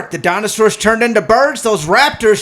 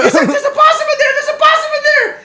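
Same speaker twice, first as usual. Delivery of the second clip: panicked yelling. Retold or imitated. Coworker bursting out of the shed, panicked laughing yells. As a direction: scream it like you're running from a possum. Friend fleeing possum, panicked.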